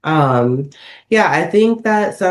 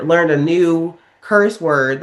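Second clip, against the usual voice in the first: ordinary talk, nothing marked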